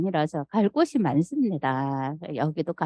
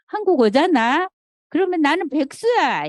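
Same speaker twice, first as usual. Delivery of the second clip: high, whiny quoted daughter; daughter's complaint; 10 semitones higher, 4 dB louder than usual. Retold or imitated. Daughter to mother, complaining in a raised whine. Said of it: "이러는 거예요".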